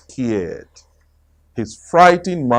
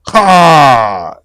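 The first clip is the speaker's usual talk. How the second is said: loud startled yelp; loud frightened rabbit yell; 8 dB louder than usual. Acted. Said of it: "yelled Rabbit".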